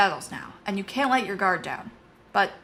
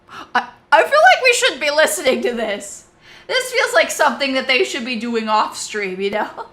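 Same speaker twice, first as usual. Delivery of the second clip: laughing; 5 semitones higher, 10 dB louder than usual. Real, not acted. Laughing aside at the dialogue.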